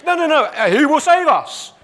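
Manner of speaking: hammed-up shocked friend; alarmed protest. Imagined fellow prisoner objecting to his friend, alarmed. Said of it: "I'd be saying".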